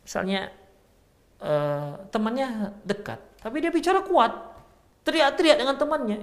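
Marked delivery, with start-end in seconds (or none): 3.4-6.2 much higher, raised voice, like someone angry; mock yelling at a friend close by; demonstrating loud talk. Shown as a demonstration. Teacher showing someone needlessly loud with a nearby friend, high raised voice. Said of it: "seperti orang marah lah gitu".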